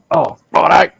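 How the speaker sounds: high, loud burst of understanding; acted-out keen acceptance; sudden eager agreement. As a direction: agree brightly as the penny drops. Acting out his sudden understanding.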